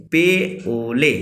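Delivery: पे ओले said very slowly, word by word; slow, with pauses. Shown as a demonstration. Teacher drilling the Kharia phrase with class, slow and paused. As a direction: space out पे and ओले for learners. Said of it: "रुक के बोलना है".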